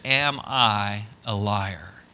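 very slow, word by word; stern question. Acted. God confronting the preacher, slow stern question. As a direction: spell it out with weighty pauses.

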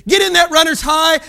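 ordinary delivery

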